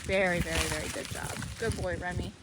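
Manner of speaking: low, hushed, breathy praise; soft praise. Real, not acted. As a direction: soothe him with quiet praise. Soft praise to calm dog.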